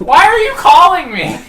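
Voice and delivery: high, loud mock complaint; fed-up wife. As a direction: whine loudly like an annoyed wife. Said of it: "play like your wife that just was like".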